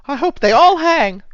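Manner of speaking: high, put-on old woman; old lady voice. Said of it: "She says".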